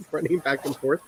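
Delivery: ordinary delivery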